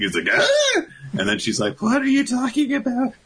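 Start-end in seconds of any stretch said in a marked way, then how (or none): 0.3-0.8 very high imitated shriek; short, comic squeal of shock; shocked reaction yelp. Emma reacting to Ray's confession, mock shocked shriek. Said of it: "we get Emma reacting". 1.8-3.1 very high, squeaky mock disbelief; shocked reaction. Emma reacting to Ray's confession, comically high disbelief. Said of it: "we get Emma reacting".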